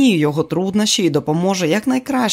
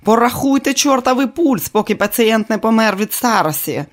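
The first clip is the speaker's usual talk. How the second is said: angry director; 6 semitones higher, 3 dB louder than usual. Acted. Director yelling at actress.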